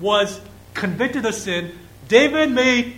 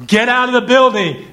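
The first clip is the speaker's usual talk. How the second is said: loud, higher urgent warning to get out; urgent shout; 5 semitones higher, 6 dB louder than usual. Retold or imitated. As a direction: yell it like an alarm call. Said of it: "no matter how, what tone it is".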